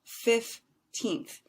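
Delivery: put-on "fifteenth" in two drawn-out, separated beats, fif-teenth; two-syllable fifteenth. Shown as a demonstration. Teacher showing two syllables, "fifteenth" slowly broken in two. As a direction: pronounce "fifteenth" slowly, both syllables distinct. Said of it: "two syllables".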